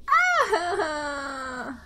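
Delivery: drawn-out fake sob; mock wail. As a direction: let out a whiny cry. Mock crying over mistake.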